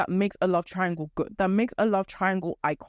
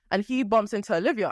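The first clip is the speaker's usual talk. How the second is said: ordinary delivery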